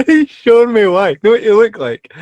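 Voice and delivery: mocking through laughter; laughing. Real, not acted. Laughing at friend's hat.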